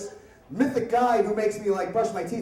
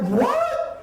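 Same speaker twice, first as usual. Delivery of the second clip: squeaky, high disbelieving yelp; a high startled "What?" with a laugh; shocked what. Retold or imitated. Teen hearing the police are outside, high startled yelp. Told the police surrounded house.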